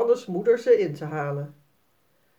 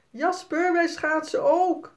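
hoog, vrolijk roepend kind; schreeuwen; 10 semitones higher than usual. A narrator's reading. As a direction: roep blij over het ijs. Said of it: "schreeuwt Sanne". (meisje roept blij naar Jasper)

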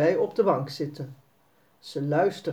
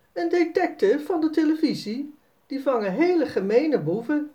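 put-on a small boy showing off; hint of a proud young boy; boasting boy; 9 semitones higher than usual. Narrator voices Jasper explaining.

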